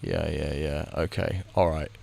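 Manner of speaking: ordinary talk, nothing marked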